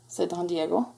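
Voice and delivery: ordinary delivery, nothing marked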